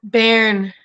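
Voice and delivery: "Bairn" said as one syllable, like "cairn"; "Bairn" ko ek hi syllable mein, "cairn" ki tarah; pronunciation demo. Shown as a demonstration. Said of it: "That's how I would read it".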